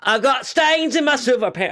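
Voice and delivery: high mock British voice, laughing through it. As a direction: squeal it out in a silly British rocker voice. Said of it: "I don't know why he's British in this".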